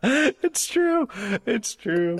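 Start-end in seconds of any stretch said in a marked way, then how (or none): 0.1-1.1 excited. Real, not acted. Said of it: "laughing admission, repeated".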